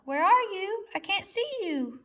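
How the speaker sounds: high calling frog voice; a frog calling out, searching; croaking frog call. A narrator's reading. Frog croaks searching for its mate, high calling voice. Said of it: "One would croak".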